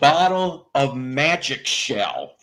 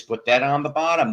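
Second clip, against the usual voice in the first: ordinary talk, nothing marked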